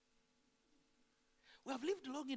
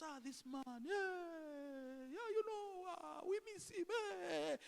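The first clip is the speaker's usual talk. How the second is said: higher, wailing mock grief; mock mourning; 6 semitones higher, 4 dB quieter, slower than usual. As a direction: sob out the eulogy like a mourner. Mourner acting grief at funeral.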